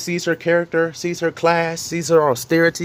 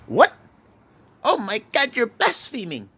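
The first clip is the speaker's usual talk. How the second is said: a touch of mock horror, raised pitch; mock shock; 6 semitones higher, slower than usual. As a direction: play a scandalised believer, lightly. Imitating a shocked objector.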